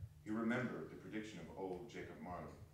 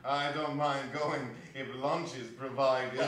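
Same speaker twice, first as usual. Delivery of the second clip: louder, slow, ending in a high laugh; laughing joke; 3 semitones higher, 6 dB louder, slower than usual. Acted. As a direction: joke about lunch and laugh. Gentleman joking with laugh.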